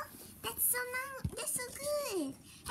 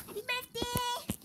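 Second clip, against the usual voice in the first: ordinary talk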